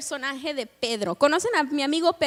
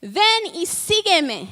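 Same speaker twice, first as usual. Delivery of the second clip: slow, louder call across the water; mild mock shout, drawn out; 3 semitones higher, 5 dB louder, much slower than usual. Jesus calls Peter from the shore, slow, louder call. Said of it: "les grita y les dice".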